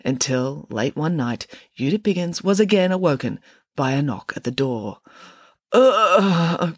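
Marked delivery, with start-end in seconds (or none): 5.7-6.8 loud drawn-out groan, pitched up; exasperated long groan; weary groan. A narrator's reading. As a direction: groan at being disturbed. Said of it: "groaned Mary".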